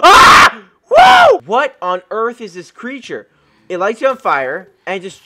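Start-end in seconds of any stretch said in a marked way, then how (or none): 0.0-0.6 startled scream. Real, not acted. Demon mob attacks. 0.9-1.4 very high, loud surprised hoot; high startled whoop. Real, not acted. Demon mob attacks.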